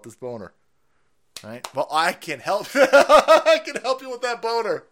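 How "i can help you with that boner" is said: laughing mock offer.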